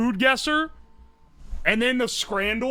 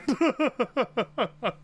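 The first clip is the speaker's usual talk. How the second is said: short run of chuckles; laughing; 3 dB quieter than usual. Real, not acted. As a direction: break into a quick staccato laugh. Laughs at the accent joke.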